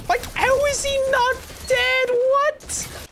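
very high, slow disbelieving squeak; can't believe the enemy lived; incredulous. Real, not acted. Player sees an enemy survive, very high incredulous squeal.

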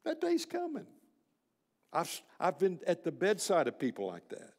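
normal talking voice

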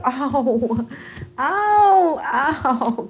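high-pitched "ow" cries; pained yelps. Real, not acted. Owner nipped by her puppy Casper, yelping in pain. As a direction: yelp as if being nipped.

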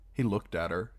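ordinary delivery

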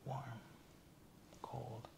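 ordinary delivery

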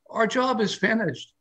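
high, drawn-out voice of a building; studio talking to itself. Acted. Studio tells itself [name] is gone, high and slow. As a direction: speak for the walls, high and slow. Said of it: "basically says to itself, if these walls could talk".

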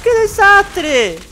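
very high, slow, loud wail; wail of dismay. Real, not acted. Gamer lamenting their own blunder, high wail. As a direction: stretch it into a squealed lament.

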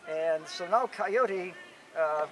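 usual speaking voice

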